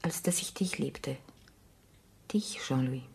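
a tender, voiceless whisper. Acted. Carol admitting her love to Jean-Louis, whispered. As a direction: let the confession slip out in a breath.

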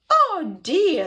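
mock sob, high and loud; sobbing grandma. A narrator's reading. Grandma cannot free Felix, hammed-up loud sob. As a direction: cry it out as an upset Grandma. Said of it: "sobbed Grandma".

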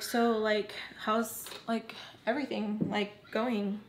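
halting, with gaps between words; imitated awkward small talk. Friend struggling to start a conversation, awkward halting imitation. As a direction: grope for each next word. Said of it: "doesn't know how to start a conversation".

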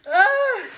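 high, loud tearful squeal; short wail of overwhelmed joy; real high sob of delight; happy cry. Real, not acted. Crying over the cute gift.

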